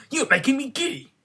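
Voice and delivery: Queen shrieks at the bowing gardeners; scream. A narrator's reading. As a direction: shriek it up high. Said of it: "screamed the Queen".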